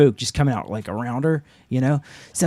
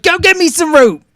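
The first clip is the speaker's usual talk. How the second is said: loud, much higher barked command; bossy shout; 9 dB louder than usual. Retold or imitated. Man taking charge at the fire yells for rope, shouting. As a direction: yell the order like you're in charge. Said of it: "I'm like".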